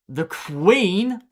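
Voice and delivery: slow, patient restart; said slowly. Shown as a demonstration. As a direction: lay it out slowly, word by word. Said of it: "I'll say this slowly".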